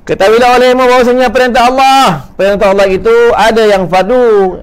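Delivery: high, loud declaimed recital; bacaan teks kitab. Acted. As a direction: proclaim the text at full voice. Reads out the lesson text. (membaca kitab dengan suara tinggi dan lantang)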